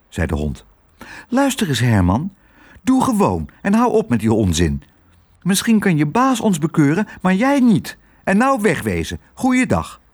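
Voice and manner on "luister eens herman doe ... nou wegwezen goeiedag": put-on high, firm, scolding child voice; high-pitched, no-nonsense dismissal; plucky girl. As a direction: tell the dog off boldly.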